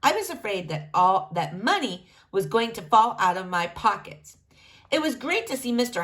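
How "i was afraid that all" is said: ordinary delivery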